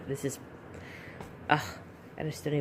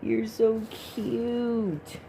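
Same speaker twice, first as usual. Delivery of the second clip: drawn-out, higher coo; adoring coo; 5 semitones higher, much slower than usual. Real, not acted. Collector to her new doll, cooing adoringly.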